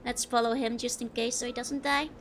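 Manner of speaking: ordinary talk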